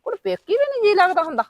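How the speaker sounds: high, startled question; surprised question. Acted. Mother asks her daughter in high surprise. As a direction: rise up in surprise at her words.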